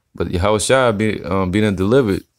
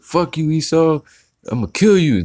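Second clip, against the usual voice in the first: higher-pitched imitation of a hothead threatening; mock tough talk; 9 semitones higher than usual. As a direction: mimic a loudmouth making threats. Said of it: "they be out there like".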